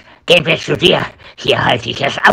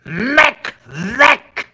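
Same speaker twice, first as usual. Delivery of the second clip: angry yell; 8 semitones higher than usual. Acted. Maximus chases Igor away.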